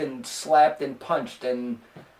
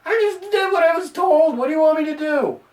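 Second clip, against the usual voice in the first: higher, pleading what-do-you-want-from-me; answering the haters, fed up and higher; exasperated defense; 9 semitones higher than usual. Real, not acted. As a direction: throw up your hands at the critics. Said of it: "And it's like".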